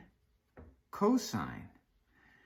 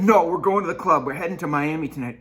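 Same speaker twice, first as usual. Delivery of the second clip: loud, fast party hype; hyped; 4 semitones higher, 8 dB louder, faster than usual. Pretend. Cosine hyping a sick friend to go clubbing, loud and fast. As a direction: brush off the sadness, pumped.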